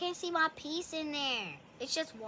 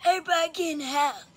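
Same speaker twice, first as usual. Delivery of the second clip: put-on raised, quick old man holler; 5 dB louder, faster than usual. Shown as a demonstration. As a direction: bark it like a grumpy grandpa. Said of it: "This is my old man voice".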